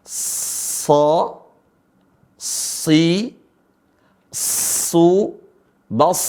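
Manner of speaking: "Sa. Si. Su. Bas." with long heavy hissed s; separated syllables, sustained sibilant; sad articulation drill. Shown as a demonstration. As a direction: stretch the thick Arabic s in each of "Sa. Si. Su. Bas.". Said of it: "Ikutin bagaimana cara mengucap huruf sad".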